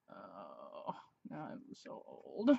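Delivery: slow whispered aside; whispered lament. Real, not acted. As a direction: breathe it out like a weary confession.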